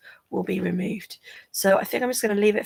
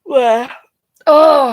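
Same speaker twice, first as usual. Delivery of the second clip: yawning; 5 semitones higher, 11 dB louder than usual. Real, not acted. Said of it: "I hate it that I keep yawning".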